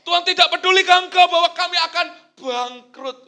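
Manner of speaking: loud, high frantic cry; mock desperate shout to God; panicked plea. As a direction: wail it like you're going under. Mock panicked prayer about bankruptcy.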